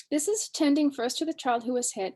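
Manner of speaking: ordinary talk, nothing marked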